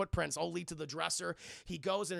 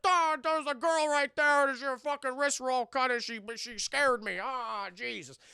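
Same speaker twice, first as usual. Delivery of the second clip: slow, higher, louder mock panic; hammed-up frightened cop; shaken cop; 7 semitones higher, 6 dB louder, slower than usual. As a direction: tell it like a rattled cop. Scared cop reports in retold film.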